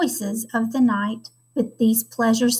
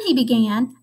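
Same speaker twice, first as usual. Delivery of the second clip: ordinary delivery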